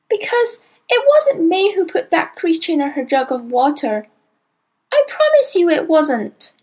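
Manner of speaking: put-on high, earnest child's voice pleading innocence; a small girl anxious to be believed; little girl Matilda. A narrator's reading. Matilda's lines in the story.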